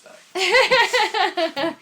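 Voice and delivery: laughing through the words, high and loud. Real, not acted. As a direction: burst out laughing as you say it. Laughing at R-rated Jetsons idea.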